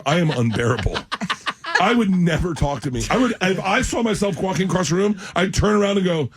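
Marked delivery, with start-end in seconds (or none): none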